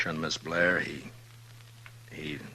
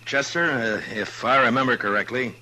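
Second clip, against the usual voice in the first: louder, lighter change of mood; brisker, louder; 6 semitones higher, 6 dB louder than usual. Acted. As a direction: shake off the gloom, suggest a drink. Lifting mood after sad scene.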